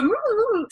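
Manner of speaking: high, drawn-out "ooh" on the exhale; calming breath out. Shown as a demonstration. Fast-talking host pausing to calm down, high exhaled ooh. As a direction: let out a demonstrative, high "ooh" to slow down. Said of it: "just take a deep breath".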